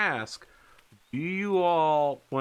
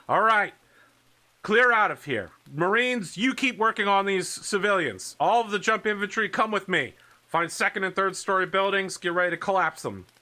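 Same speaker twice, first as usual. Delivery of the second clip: high, raised field-officer voice; commanding orders; 7 semitones higher, 4 dB louder than usual. Acted. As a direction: call orders across the spaceport. Rapier ordering troops at spaceport.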